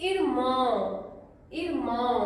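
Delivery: इरमाओ ending in a nasal ao glide; nasal irmão. Shown as a demonstration. Teacher modelling a nasal इरमाओ for students. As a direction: let the ao of इरमाओ ring through the nose. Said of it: "again nasal sound".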